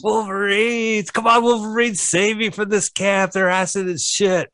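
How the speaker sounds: overacted death scene. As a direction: beg melodramatically from the camp. Hammed dying plea from the movie.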